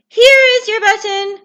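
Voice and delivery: eager Frog; hopeful Frog. Acted. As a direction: offer it hopefully.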